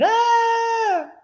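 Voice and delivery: high, piercing imitated scream; mock scream. Imitating a woman panicking when disaster strikes, high shriek. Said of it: "start screaming".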